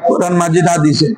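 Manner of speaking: ordinary talk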